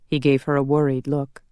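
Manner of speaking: plain reading voice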